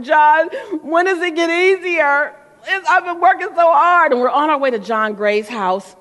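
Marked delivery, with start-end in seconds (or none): 0.0-4.1 high, slow, tearful plea, half-mocking her tired self; a teary whine, slow and high; retold crying. Tired speaker on tour whining to her mentor, high and teary. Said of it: "so I started crying".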